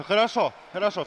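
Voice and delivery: normal talking voice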